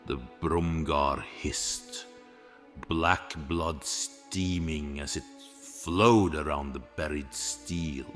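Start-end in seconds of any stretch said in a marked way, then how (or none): none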